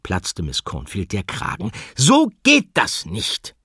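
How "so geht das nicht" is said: high, loud, slow fed-up cry; exasperated teacher shout. Acted. Teacher losing her temper with the animals, loud slow cry. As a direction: lose your patience and call it out. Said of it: "rief sie".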